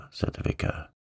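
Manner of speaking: usual speaking voice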